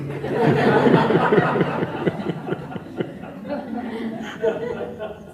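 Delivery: long breathy chuckle, high; delighted laughter after the punchline; real amused laugh; high airy laugh. Real, not acted. Laughs at the mother's joke.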